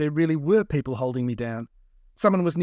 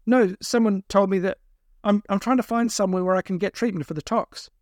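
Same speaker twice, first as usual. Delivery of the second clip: higher, defensive pleading; a touch of nervous protest, pitched up; lightly voiced anxious captive; 6 semitones higher, 3 dB louder than usual. A narrator's reading. Captive explaining himself to kidnappers, anxious.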